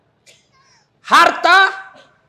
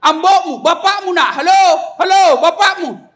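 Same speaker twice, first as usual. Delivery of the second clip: loud, high hollering down the line; shouting into the phone; 7 semitones higher, 6 dB louder than usual. Acted. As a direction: play a father bellowing at an unconnected phone. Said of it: "lalu dia berteriak". (berteriak di telepon)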